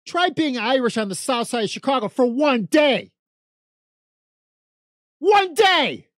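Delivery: building to a high shouted ONE DAY; exasperated shout. Real, not acted. Daring the host to try it, rising to a shout. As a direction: challenge them, then blow up.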